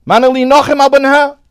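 high, loud, urgent verse; fervent preacher's high call; impassioned cry. Retold or imitated. Climax of the inconsolable mother.

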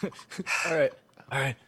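ordinary talk, nothing marked

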